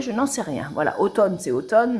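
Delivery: usual speaking voice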